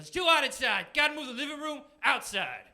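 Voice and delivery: high, loud manic outburst; frantic meth dealer. Acted. Meth dealer explaining his move outdoors, frantic shout. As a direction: holler like a wired, busy neighbour.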